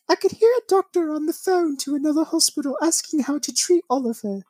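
put-on high British accent, quoting the mother; British woman. Host reading the mother's quote, high British accent. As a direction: lift into the British mum's voice. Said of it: "I gotta say it in a British accent".

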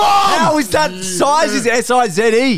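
outraged shout. Real, not acted. Outraged at spelling ruling.

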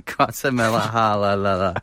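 Guatemala stretched very slowly, syllables tumbling; drawn-out struggle. Real, not acted. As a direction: drag out Guatemala, stumbling. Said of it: "it's gonna be a struggle to pronounce".

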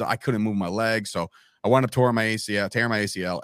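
usual speaking voice

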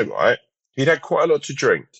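ordinary delivery

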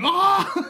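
short high-pitched mock scream. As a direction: act out a moviegoer's loud, high scream. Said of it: "as you're screaming".

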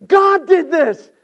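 very high, loud declaration; shouted proclamation. Acted. Joseph realizing God's hand in it, shouted proclamation. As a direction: proclaim it at full preaching force.

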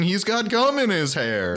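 high, drawn-out blurting out; mock outcry. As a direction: blow the secret in mock disbelief. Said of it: "And J Money says".

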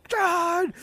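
high, shrill-pitched mock shriek, slowed down; mimicking screaming youth leader. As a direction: squeal it like the furious youth leader on the phone. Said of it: "She's like".

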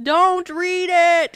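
loud, high, drawn-out plea; emphatic warning. Real, not acted. Host warning listeners off an awful book, exasperated shout. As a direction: call it out slowly, really stretching it.

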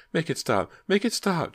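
very high, loud panicked scream; terrified shriek. A narrator's reading. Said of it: "Ginny shrieked".

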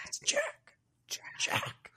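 strained high voice fading to whisper; mock dying protest. Dying Jack correcting Ron on his name, mock croak. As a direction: squeeze it out, then fade breathlessly.

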